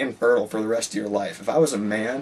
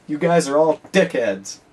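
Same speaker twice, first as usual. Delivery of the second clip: higher, louder and slow, a mock teacher jab; blunt insult; 5 semitones higher, 5 dB louder than usual. Teacher tells the class they are all dickheads, mock stern. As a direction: hammer out the insult slowly.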